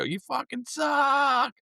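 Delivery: put-on high, slow slurred drunk jeer; drunk heckler slur. Drunk fan slurring insults at the band. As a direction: drag it out like a drunk. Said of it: "he's drunk, so he'd go".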